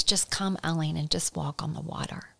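soft whispered call; whispered invitation. Acted. Jesus calling Eileen, whispered invitation. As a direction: breathe it like a secret. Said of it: "when Jesus says".

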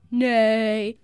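loud, drawn-out mock cow neigh; imitated animal call; cow's cry. A narrator's reading. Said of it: "innocent-looking cow that went".

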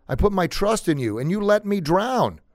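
high-pitched retold protest to God; aggrieved complaint. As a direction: reproach someone, drawing out the end. Drowned man complains to God.

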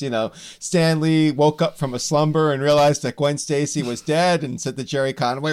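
normal talking voice

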